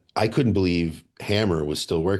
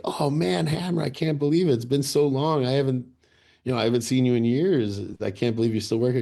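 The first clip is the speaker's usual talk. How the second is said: excited greeting; 6 semitones higher than usual. Retold or imitated. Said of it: "when I see him was like".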